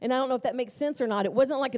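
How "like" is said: usual speaking voice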